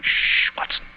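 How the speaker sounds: whispered shush in the dark; hushed whisper. Acted. Holmes hushing Watson while they wait in ambush, whispered. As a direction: hush him urgently, barely voiced.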